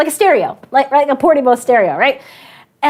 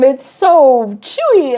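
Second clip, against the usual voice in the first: slow, drawn-out it's so chewy; slowed mock diner; polite chewing guys; much slower than usual. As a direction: chew on the words politely. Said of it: "They were sitting there and like".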